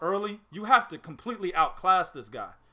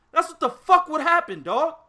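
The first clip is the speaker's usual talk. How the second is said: ordinary talk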